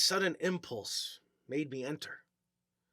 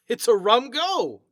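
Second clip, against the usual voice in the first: bemused; 6 semitones higher, 9 dB louder, slower than usual. Acted. Atkinson baffled by coincidence.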